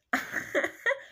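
quick unvoiced high laugh; a short, airy giggle; high breathy giggle. Real, not acted. Retelling her ex's jealousy, with a quick airy giggle.

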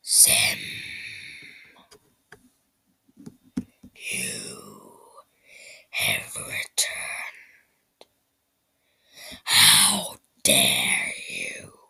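very deep, slow gravelly rasp; menacing whispered growl from the dark. Acted. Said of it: "he heard a gravelly voice".

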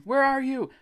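very high searching cry; screaming. A narrator's reading. Narrator searches for missing Greg, screaming. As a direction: call out in a panic. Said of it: "I screamed".

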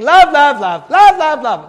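high, loud, insistent chant; hammed-up repetition. Preacher mimics John's one-note message, insistent and exaggerated. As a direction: hammer out each word like a broken record.

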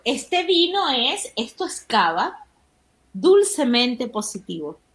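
slow, stretched promotional read; mock wine advert. Host pretending to advertise the wine, slow ad read. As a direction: savour each word like a wine commercial. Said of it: "haciendo la publicidad para el vino".

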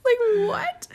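high, loud incredulous what, laughing; shocked disbelief. Retold or imitated. Wife reacting to husband's apology, high laughing disbelief. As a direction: squeal it in stunned disbelief. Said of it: "And I was like".

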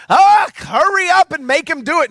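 high, loud hurried nagging; mother urging the servants, loud and high; mock shout. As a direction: bark it like a pushy mother. Wrong version of Mary's line.

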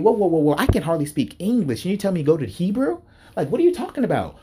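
high, quick disbelief; high-pitched, hurried mock incredulity; incredulous whoa. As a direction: pull back in mock alarm. Incredulous at being sent to Hebrew.

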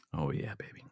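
breathy low whisper of delight. Real, not acted. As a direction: savor it in a soft whisper. Savoring wine, whispered.

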